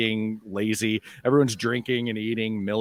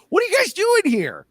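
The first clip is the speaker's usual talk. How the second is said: surprised high voice; 14 semitones higher than usual. Acted. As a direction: gasp it out way up high. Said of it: "And he's like".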